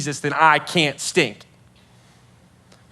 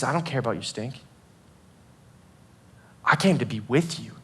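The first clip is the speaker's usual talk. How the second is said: low, soft and intimate; tender reassurance; 7 semitones lower, 9 dB quieter, faster than usual. Acted. Jesus telling someone he came to be with them, tenderly. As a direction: reassure them gently, dropping right down.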